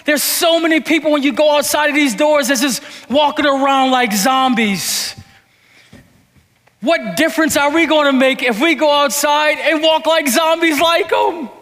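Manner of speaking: loud, high, driving preacher voice; full-force preaching. Real, not acted. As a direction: preach it out to the congregation.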